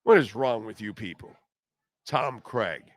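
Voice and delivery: ordinary talk, nothing marked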